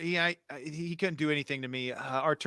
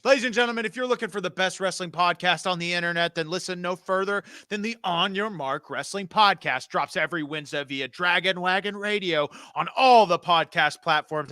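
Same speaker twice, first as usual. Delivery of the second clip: podcast promo read; 3 semitones higher, 5 dB louder than usual. Acted.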